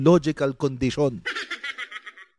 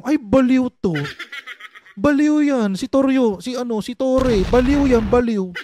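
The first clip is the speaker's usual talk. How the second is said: high, louder mock exclamations; mocking snap diagnosis; 6 semitones higher, 4 dB louder than usual. Doctor judging people at a glance, mocking. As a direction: imitate someone gleefully declaring people crazy. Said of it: "Tapos ikaw, titingin, titingin ka lang".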